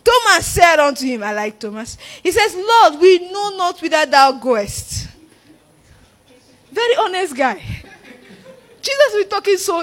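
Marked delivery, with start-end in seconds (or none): 0.0-5.1 loud, higher-pitched preaching read; forceful scripture reading. Real, not acted. Preacher reading Jesus' and Thomas's words to the congregation, forceful. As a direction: ring the verse out to the congregation.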